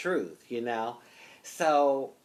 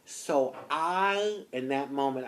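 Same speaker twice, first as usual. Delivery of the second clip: ordinary delivery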